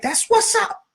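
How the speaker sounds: retold girl voice. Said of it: "she was like".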